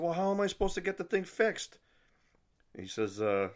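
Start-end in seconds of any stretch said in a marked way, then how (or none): 0.0-1.8 high-pitched, exasperated retort; retold indignant question; exasperated protest. Protesting to inspection clerk.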